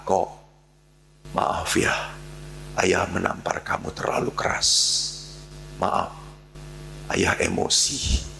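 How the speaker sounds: hushed, gentle fatherly sorry; tender apology. Retold or imitated. Stern father finally apologising to his son, hushed and tender.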